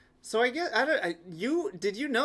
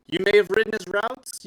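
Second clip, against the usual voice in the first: usual speaking voice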